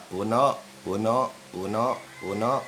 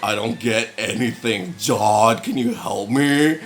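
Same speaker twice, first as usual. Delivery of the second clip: imitating classmate; 4 dB louder than usual. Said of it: "And he's like".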